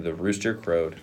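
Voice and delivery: ordinary delivery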